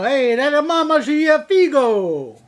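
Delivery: loud, high hammed-up Jersey Italian guy; over-the-top Italian-American street voice; mock Italian-American accent. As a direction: ham up a Sopranos-style catcall. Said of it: "That's not the way they sounded at all".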